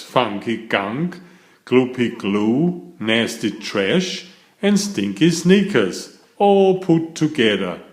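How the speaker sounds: ordinary delivery, nothing marked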